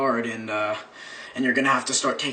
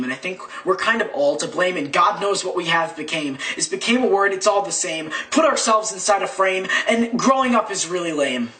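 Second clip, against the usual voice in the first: rhythmic slam-poetry build, pitched up; spoken-word climax; 5 semitones higher than usual. Acted. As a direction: drive each rhyme toward the finish. Rhymed climax of spoken-word piece.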